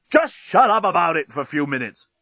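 fed-up outburst; exasperated. Acted. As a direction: cut him off angrily. Dan snaps at the journalist.

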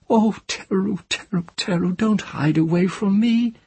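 high, pleading and tender; a frightened woman coaxing a hidden child; high-voiced tender pleading to a child; tender frightened mother. Tenar weeping over the child.

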